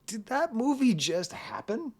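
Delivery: high, incredulous question; stunned disbelief. Retold or imitated. Reacting to the Al Pacino movie, mock stunned and high. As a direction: act dumbfounded after a wild film. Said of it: "you're like".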